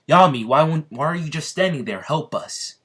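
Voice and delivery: high, loud shout that collapses into a breathless plea; desperate shout. Acted. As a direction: yell for help, then fade out. Asta begging Yami for help.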